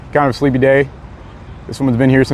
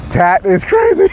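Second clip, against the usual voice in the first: higher, amazed exclamation; excited; 12 semitones higher, slower than usual. Real, not acted. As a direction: marvel at it. Amazed viewers watch stream.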